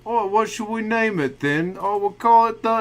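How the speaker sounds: mock British voice. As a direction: ham up a silly Brit. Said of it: "they're just like".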